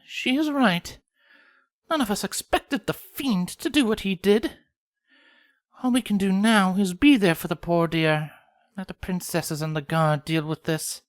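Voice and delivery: high, refined lady pony; prim and genteel; Rarity voice. A narrator's reading. Rarity calms the group, high and refined.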